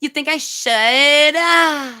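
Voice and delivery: high, half-hearted mock of a needy woman asking permission; mimicking a rival begging her subscribers for a yes. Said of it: "I can't even do her fucking voice".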